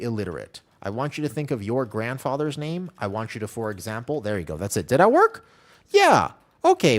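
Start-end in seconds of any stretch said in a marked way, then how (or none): 4.9-7.0 high, loud burst of excitement; excited check. Real, not acted. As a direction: shout it high as it finally works. Giveaway test suddenly seems to work.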